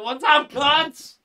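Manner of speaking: cheerful airport yell; rowdy greeting. Pretend. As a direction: yell it across the arrivals hall. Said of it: "greeting you at the airport with a".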